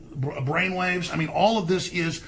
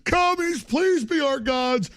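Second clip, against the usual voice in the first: high, whiny, pleading mock voice; mocking parody, pitched high and loud; mock groveling liberal women; 11 semitones higher, 3 dB louder, slower than usual. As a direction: grovel and whimper like apologetic activists. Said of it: "We're all like".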